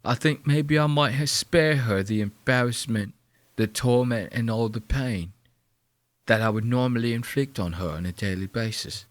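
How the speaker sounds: a hint of a creepy British bloke; mock British accent. Voicing Francis as a gloating British creep, mock accent. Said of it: "He's like".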